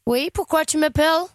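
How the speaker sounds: put-on English accent. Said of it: "avec un accent british".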